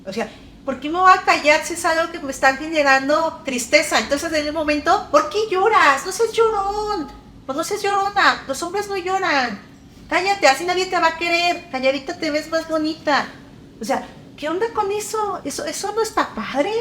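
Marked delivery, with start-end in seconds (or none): 5.1-13.3 high, scolding parent's voice, pitched way up; mock nagging parent. Imitating a parent scolding a crying child, in a high mocking voice.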